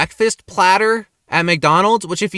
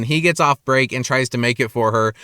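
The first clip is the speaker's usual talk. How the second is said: normal talking voice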